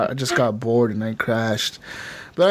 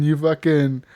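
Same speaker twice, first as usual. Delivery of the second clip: usual speaking voice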